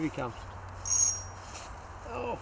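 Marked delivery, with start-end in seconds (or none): none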